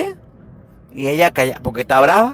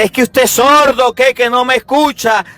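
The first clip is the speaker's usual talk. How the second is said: scolding wife; 5 semitones higher than usual. Retold or imitated. Wife scolding husband who ignores her, exasperated. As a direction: nag him for never listening.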